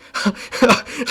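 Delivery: short high rising laugh. Real, not acted. Laughing at the ref stopping Merab, a high rising laugh. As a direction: break into a quick, high laugh.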